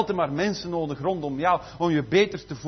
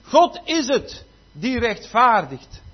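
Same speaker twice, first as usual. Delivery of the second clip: louder, slow and weighty; forceful preaching; 4 semitones higher, 8 dB louder, much slower than usual. Acted. Preacher reading Romans to the church, slow and forceful. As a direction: thunder the verse at the congregation.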